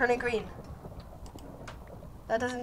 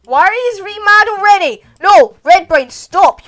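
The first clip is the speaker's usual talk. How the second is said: loud, higher panicked yelling; alarmed shout; 7 semitones higher, 19 dB louder than usual. Real, not acted. Player yelling at Red Brain to stop attacking, loud alarm.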